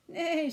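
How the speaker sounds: high loud neigh; horse neigh. Acted. Horse sound in the story.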